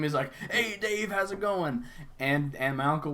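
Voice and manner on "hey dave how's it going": put-on high, delighted hail to an old acquaintance; happy greeting. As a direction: imitate a guy thrilled to spot someone. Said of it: "he's just real happy to see him".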